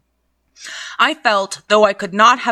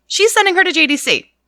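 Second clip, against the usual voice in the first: laughing; 8 semitones higher, faster than usual. Real, not acted. Reader jokes and laughs.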